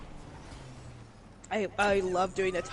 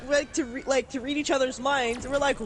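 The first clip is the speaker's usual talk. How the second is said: ordinary talk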